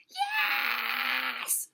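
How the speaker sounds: long, very high scream. A narrator's reading. Turtle answers Lion, screaming. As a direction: shriek it out, stretched. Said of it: "screamed Turtle".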